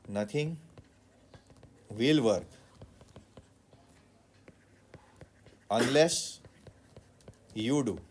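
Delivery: each word set apart by long pauses; slow emphatic pauses. Shown as a demonstration. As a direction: let every word land, pausing between Nothing, will work, unless and you do. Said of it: "What do they say?".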